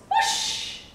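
airy, voiceless whoosh; long breathy rush of sound; whoosh noise. A narrator's reading. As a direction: imitate something rushing past. Imitates boat rushing past.

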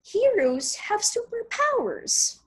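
lightly voiced little girl; a hint of a hopeful child; young girl voice. Child character quoted in story.

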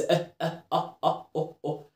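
short, soft "اه" sounds, each starting with a glottal catch; hamza vowels. Shown as a demonstration. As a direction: separate each "اه" with a clean glottal stop. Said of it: "كلهم فيهم همزه". ("اه" قصيرة بهمزة واضحة)

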